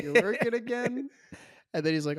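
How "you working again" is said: much higher, sly put-on cop voice; hammed-up sly cop question; mimicking the cop. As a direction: toss it off as a knowing, amused cop. Imitating the cop Jimmy.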